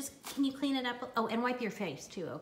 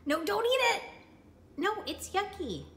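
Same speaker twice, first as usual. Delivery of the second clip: alarmed; 10 semitones higher than usual. Real, not acted.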